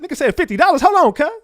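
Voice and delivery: high and loud, hammed-up panic; mock frantic yell. Quoting himself grabbing fifty dollars, high mock yell. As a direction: squeal it out like you're rushing to grab money. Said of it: "I said".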